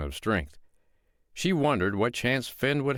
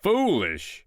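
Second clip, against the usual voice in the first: outraged giant, higher and louder on one word; indignant roar; 9 semitones higher, 3 dB louder than usual. Acted. Giant roaring back at the woman who called him foolish. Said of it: "roared the giant".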